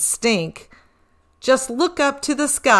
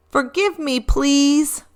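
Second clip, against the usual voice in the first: a little higher, fussy reindeer voice; sheepish reindeer, a touch embarrassed; mildly embarrassed Rudy; lightly voiced Rudy; 6 semitones higher than usual. A narrator's reading. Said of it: "said Rudy".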